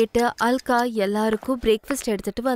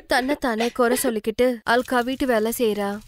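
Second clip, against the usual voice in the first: ordinary delivery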